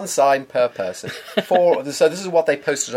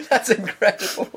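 laughing; 7 semitones higher than usual. Real, not acted. Incredulous at cafe rule.

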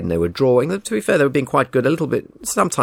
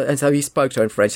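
ordinary delivery

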